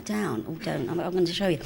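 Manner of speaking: ordinary talk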